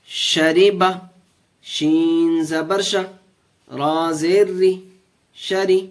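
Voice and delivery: steady, measured recitation of each syllable; spelling drill. Shown as a demonstration. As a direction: lead the class through the spelling. Teacher drills syllable joining.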